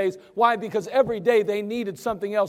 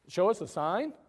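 incredulous echo; 3 semitones lower, 6 dB quieter than usual. Shown as a demonstration.

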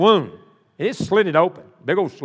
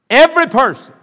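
louder repeat; 8 semitones higher, 7 dB louder than usual. Real, not acted. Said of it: "We need every person".

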